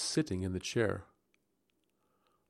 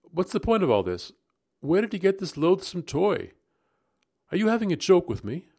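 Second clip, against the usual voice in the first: sharp, higher and louder, fast accusing questions; sharply; 9 semitones higher, 6 dB louder than usual. A narrator's reading. Narrator demanding why Rebecca keeps the doll, sharp and fast. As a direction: demand answers, rattled and suspicious. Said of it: "I spoke sharply".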